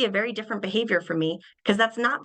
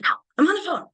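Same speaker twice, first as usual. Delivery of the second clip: put-on high, loud, annoyed teenager snapping; mock teen hiss; 9 semitones higher, 4 dB louder, faster than usual. Teenager on the phone snapping at the poking parent, high and loud. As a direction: brush off the parent's poke sharply. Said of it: "They hiss".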